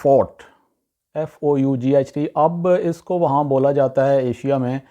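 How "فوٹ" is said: "فوٹ" with one long open "aw" vowel; correct pronunciation. Shown as a demonstration. Teacher introduces the English word fought, correct pronunciation. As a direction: say "فوٹ" to rhyme with "thought". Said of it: "actual جو ہے اس کی pronunciation".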